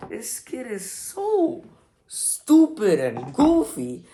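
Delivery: slow, stretched-out disbelief; drawn-out exasperation. Real, not acted. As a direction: drag the words out, exasperated. Exasperated reaction to the video.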